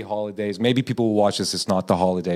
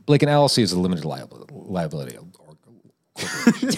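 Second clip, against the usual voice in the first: fast mock lecture; 5 semitones lower than usual. Mock-formal answer.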